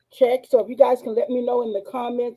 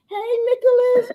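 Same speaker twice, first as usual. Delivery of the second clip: high singsong hello; playful high-pitched greeting; 13 semitones higher, 3 dB louder than usual. Pretend. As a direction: squeal a teasing shout-out. Playful greetings after being hushed.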